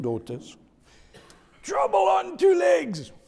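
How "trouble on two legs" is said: high, loud shout with "two" punched; preacher shouting Peter's nickname, loud and high. Shown as a demonstration. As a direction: yell it out.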